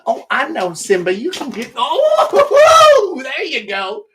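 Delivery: high, loud whooping with laughter; over-excited. Acted. Host mocking his own excitability, over-excited. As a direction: whoop it up, giddy. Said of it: "get a little excited from time to time".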